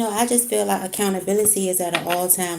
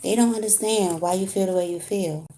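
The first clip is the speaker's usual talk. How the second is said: ordinary talk, nothing marked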